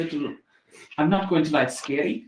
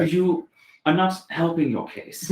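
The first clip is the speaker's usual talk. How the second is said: put-on German accent; 4 semitones higher, 8 dB louder than usual. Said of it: "I have finally got my German accent".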